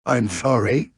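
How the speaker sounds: lightly voiced tiger apology; a slight character voice for an apologizing tiger; Tigger. Tiger apologizes to the little pig, lightly voiced character. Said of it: "said Tigger".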